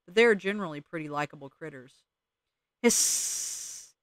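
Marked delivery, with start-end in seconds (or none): 2.8-3.9 sharp breathy hiss through the teeth; opossum hiss. Acted. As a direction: spit a warning hiss like a cornered opossum. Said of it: "the hideous warning the opossum spat".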